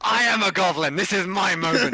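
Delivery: high, loud, playful outburst; mock-outraged shout. Real, not acted. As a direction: shout it high to claim your moment. Player claims the goblin moment.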